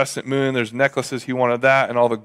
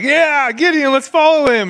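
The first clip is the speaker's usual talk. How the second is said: much higher, louder cheering townsfolk; eager crowd voice; 12 semitones higher, 4 dB louder than usual. Retold or imitated. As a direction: cheer him on like an excited mob.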